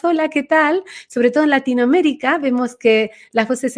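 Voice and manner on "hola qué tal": high, small voice lifting at the end; pitched up, detached chirp of a greeting; high disconnected little voice. Shown as a demonstration. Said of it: "muy agudas, muy desconectadas".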